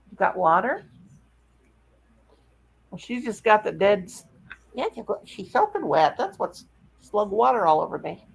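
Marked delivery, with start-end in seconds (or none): none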